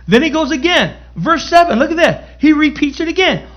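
excited preaching. Real, not acted. Preacher rousing the saints over repeated verses, excited. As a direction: lift the room with rising excitement.

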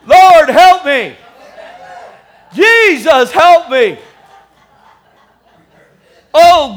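loud mock-desperate plea; crying out to God. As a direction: holler for divine help, hammed-up. Pastor cries to God after joke.

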